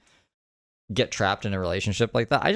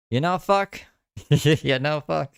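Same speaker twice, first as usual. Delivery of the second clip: high, laughing swear; laughing curse; 7 semitones higher, 3 dB louder, slower than usual. Real, not acted. Shrugging off facing illness alone, laughing.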